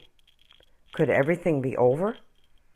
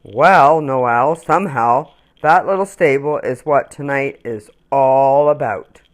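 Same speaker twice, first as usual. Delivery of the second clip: louder, kindly tree; big tree voice; 7 dB louder than usual. Acted. Tree Brutus answers the small ornament, lightly voiced. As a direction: answer like a gentle giant tree. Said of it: "answered Brutus".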